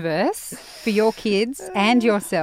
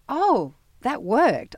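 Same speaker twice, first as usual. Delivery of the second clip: slow, high note of dawning realisation; acted slow amazement, pitched up; pleasantly surprised; 6 semitones higher, 4 dB louder, much slower than usual. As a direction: draw it out as a surprised discovery.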